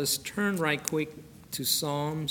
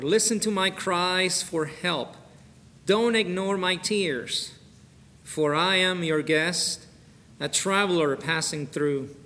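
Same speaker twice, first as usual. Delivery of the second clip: fervent louder reading of a plea; pleading scripture reading; 3 semitones higher, 6 dB louder than usual. Acted. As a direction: cry the verse out to the Lord. Reads Psalm thirty-nine plea.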